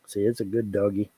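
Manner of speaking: ordinary delivery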